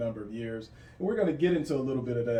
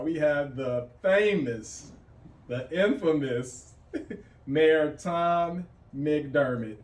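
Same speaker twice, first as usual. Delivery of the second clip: slow, drawn-out hype introduction; announcer intro; 3 semitones higher, 5 dB louder, much slower than usual. Acted. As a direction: stretch each word like a ring announcer. Host announces the famous mayor.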